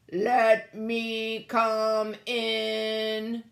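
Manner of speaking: very slow, drawn-out robot voice; creepy robot plea. A narrator's reading. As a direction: stretch each word like a lurking robot. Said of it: "he queaked".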